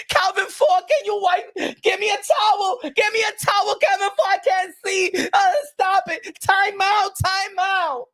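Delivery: high, shouting, whiny mock panic; mock Tank Davis. Mocking Tank Davis calling Calvin Ford, mock crying shout. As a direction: beg for the towel in hammed-up distress.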